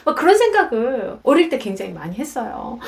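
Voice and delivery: ordinary talk, nothing marked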